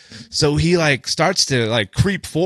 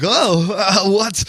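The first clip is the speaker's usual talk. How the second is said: higher-pitched imitation of a giggly drunk; mock clueless, raised-pitch voice; giggly and oblivious; 7 semitones higher, much slower than usual. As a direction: play dumb, as if tipsy and amused. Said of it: "Just, like, giggling".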